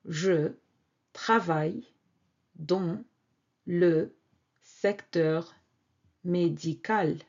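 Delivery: "je travaille dans le secteur médical" with a pause after each word. Shown as a demonstration.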